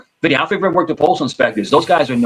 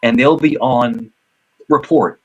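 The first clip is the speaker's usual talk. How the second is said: ordinary talk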